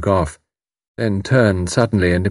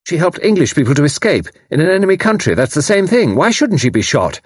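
high, quick, argumentative retort; fierce young voice; 8 semitones higher, 3 dB louder than usual. Acted. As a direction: fire back fiercely, pitch up. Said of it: "demanded in her fierce young voice".

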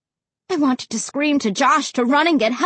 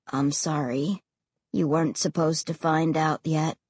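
deep, heavy ghost voice; low and heavy; 8 semitones lower, 6 dB quieter than usual. A narrator's reading. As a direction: let it float low and heavy. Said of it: "his voice floating low and heavy".

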